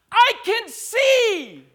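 high, loud, drawn-out cry; joyful shout. Retold or imitated. Preacher acting out a healed blind woman, shouting. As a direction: imitate her shout with full force. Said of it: "and she shouted".

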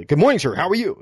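loud, high, sure "Good morning, sir"; confident American greeting. Retold or imitated. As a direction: greet like a certain, upbeat greeter. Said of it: "very certain of themselves".

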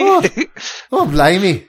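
loud, high mock-Irish oh blimey; hammed-up Irish exclamation. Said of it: "Sorry to all the Irish people".

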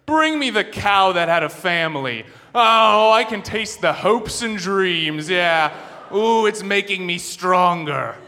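loud, high, slow gloating king; evil king. Retold or imitated. Evil king on his throne demanding his dinner, slow gloating. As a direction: savour each word like a greedy tyrant. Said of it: "what evil king is sitting on his throne".

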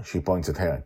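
usual speaking voice

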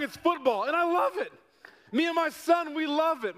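preacher lights up about his passion; excited gushing. Real, not acted. Rattling off football all week.

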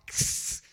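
unvoiced hissing snake sound. Preacher imitating the snake with a hiss.

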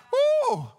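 high, loud "woo"; high whoop. Real, not acted. Preacher breaking off his list to the church, high loud whoop. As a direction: let out a sudden high whoop.

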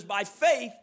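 "faith" leaned on and drawn out, falling; "faith" given weight with a falling close; stress on faith. Shown as a demonstration. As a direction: land hard on "faith". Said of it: "those verses there that I tried to emphasize".

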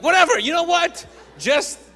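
high, loud burst of frustration; exasperated. Retold or imitated. Husband giving up explaining to his wife, exasperated. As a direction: cut off the confusion, fed up. Fed up with being misunderstood.

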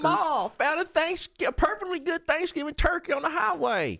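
hammed-up hillbilly. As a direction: brag like a proud country kid. Jokey hillbilly character voice.

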